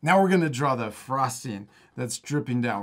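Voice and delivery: ordinary delivery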